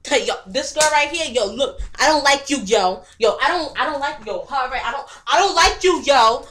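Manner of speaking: loud, emphatic street-tough challenge; hostile confrontation. Acted. Girl tells April she dislikes her, loud and hostile. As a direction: confront her loudly, hammering the dislike home.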